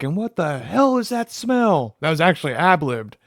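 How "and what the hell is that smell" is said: imitated movie yell. Fan retelling the ad-libbed line, high drawn-out mock yell. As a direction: stretch out the hero's complaint at a raised pitch. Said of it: "that's the reason he yells out".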